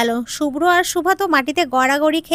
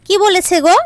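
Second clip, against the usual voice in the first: eager, high and louder; excited; 5 semitones higher, 4 dB louder than usual. A narrator's reading. Girl hears her suitor called, thrilled and high. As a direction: jump at the news, thrilled. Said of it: "Saira উৎফুল্ল হয়ে উঠল".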